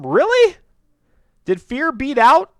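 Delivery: ordinary delivery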